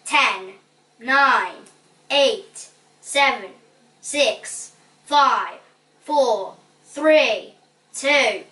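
presenter counting off the final seconds; measured, punched countdown. A narrator's reading. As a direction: count down in spaced, falling calls. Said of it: "As the final countdown began".

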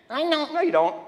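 very high mock "I know"; fake believer. Preacher mocking someone faking faith, very high. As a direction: mimic a phony believer.

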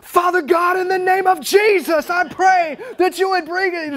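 booming public prayer, pitched high; loud street prayer. Retold or imitated. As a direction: call out the prayer for the whole gas station to hear. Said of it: "I want the world to hear me".

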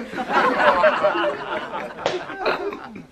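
squeaky giggle; high-pitched laugh. Real, not acted. (risa aguda)

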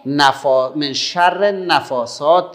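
loud, slow, drawn-out recitation; measured, projected Quran teaching voice; recitation model. Shown as a demonstration. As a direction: call out the verse slowly for pupils to repeat. Models phrase for pupils to repeat.

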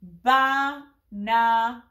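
banana drawn out slowly as ba-na-na; teacher spelling out banana syllable by syllable; slow syllable split. Shown as a demonstration. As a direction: stretch banana into three even beats. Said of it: "for a three syllable word".

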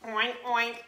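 usual speaking voice

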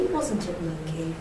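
ordinary delivery

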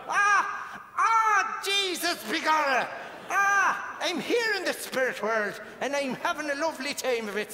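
hammed-up Irish, high exclamations first. Medium ordered to do dead dad's Irish voice, hammed-up. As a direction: put on a cheery stage Irish voice. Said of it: "He was Irish. Do the voice".

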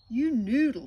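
loud, high squawk of scorn; loud high parrot squawk; squawked scold. Acted. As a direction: squawk the insult at him. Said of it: "squawked Polly".

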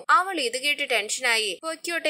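usual speaking voice

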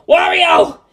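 loud high shouts; yelling. Acted. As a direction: call out desperately for your friend. Yelling while running.

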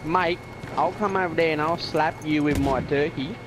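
broad Australian accent with stretched vowels. Shown as a demonstration. Friend showing off for the audience, broad Australian accent. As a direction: threaten a mate in broad Aussie. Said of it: "give us your best Australian accent".

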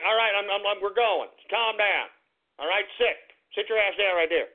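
ordinary talk, nothing marked

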